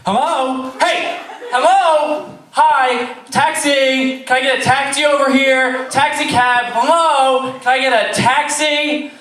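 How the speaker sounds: high loud calls for a cab; hailing a taxi. Acted. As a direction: shout across the street for a taxi.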